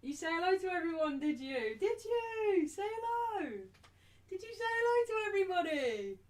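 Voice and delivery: high, sing-song questions to a puppy; dog-talk voice. Real, not acted. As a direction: coo at the dog to say hello. Talking to the dog.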